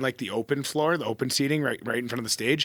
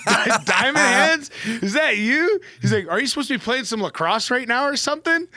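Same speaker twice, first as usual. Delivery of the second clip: high, loud imitated astonishment; amazed fan questioning, acted out; incredulous stranger; 8 semitones higher, 3 dB louder than usual. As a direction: retell the disbelief, pitched right up.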